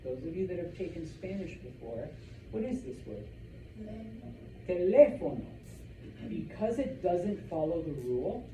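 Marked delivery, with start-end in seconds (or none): 4.7-5.4 "Teléfono" as te-LÉ-fo-no, LÉ loud; stress on accented E. Shown as a demonstration. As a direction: punch the LÉ in "Teléfono". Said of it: "we put an accent mark on that E".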